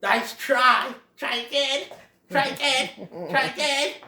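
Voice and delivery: high, taunting repeated call; porcupine. Acted. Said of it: "the porcupine says".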